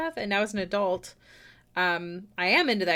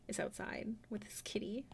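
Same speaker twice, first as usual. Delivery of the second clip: whisper; 15 dB quieter than usual. Real, not acted. Whispers about neighbor's cat.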